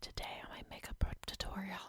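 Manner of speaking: soft ASMR whisper; breathy whisper into the mic. Pretend. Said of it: "I don't have the A S M R voice".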